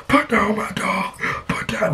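high slow desperate plea; desperate owner. Retold or imitated. Dog owner desperately begging him to put down the dog, high and slow. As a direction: beg for the dog back. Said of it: "he was going crazy".

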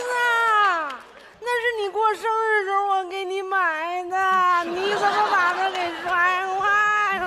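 theatrical bawling; crying. Acted. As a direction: sob the words out in one long, high whine. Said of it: "大米，你别哭了好吗？".

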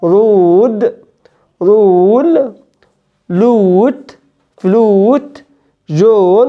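رول said slowly with a pure "oo" and no "y"; plain long "oo". Shown as a demonstration. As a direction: keep "oo" pure, no "y", in رود، رول، لوت، فلوت، جون. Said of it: "نقولهم تاني". (أو من غير يا)

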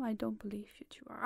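quick unvoiced whisper; fast whisper. Real, not acted. Whispering an aside to her pets, fast. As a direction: rush it under your breath.

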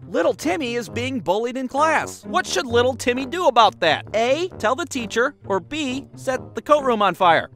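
high, mock-childish quiz reading; worksheet reader. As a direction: read it like a simplistic question for little kids.

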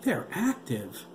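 alarmed cry. A narrator's reading. Doctor warns a colleague they're active, alarmed. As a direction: stretch it out in dismay. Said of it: "Doctor McAllister cried".

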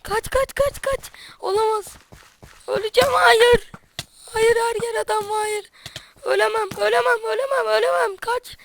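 high, loud, frantic panic; yelling in terror while fleeing; panicked screaming. Real, not acted. Fleeing enemies in a game.

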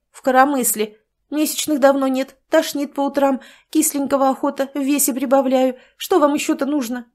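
almost crying with frustration; near tears. Acted. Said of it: "Чуть не плакала пациентка".

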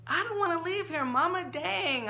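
high, pleading whine; whiny kid. A narrator's reading. Girl whining to Mama about moving. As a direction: whine like a child begging to stay. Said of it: "I whined".

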